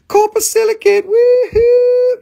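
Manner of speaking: high, loud excited squeal. Real, not acted. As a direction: squeal with delight. Excited about favourite mineral.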